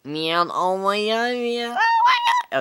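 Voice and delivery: silly voice. Pretend. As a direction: stretch it up into a piercing squeak. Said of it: "this is gonna be my voice".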